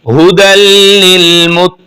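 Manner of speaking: हुदल recited with a clear, audible h sound; clear recitation. Shown as a demonstration. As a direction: make the h of हुदल heard plainly. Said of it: "हा की आवाज सही जाहिर करनी है".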